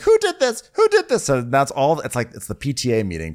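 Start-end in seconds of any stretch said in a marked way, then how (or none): 0.0-1.2 shrieked much higher and louder; outraged mother shrieking, far higher and louder; mock outraged shriek. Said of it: "then she's like".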